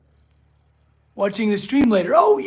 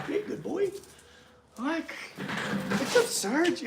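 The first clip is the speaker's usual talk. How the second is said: much higher and softer, cooing praise; 8 semitones higher, 10 dB quieter than usual. Real, not acted. Praising the skittish rescue dog, soft high cooing. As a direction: coo softly to a shy dog.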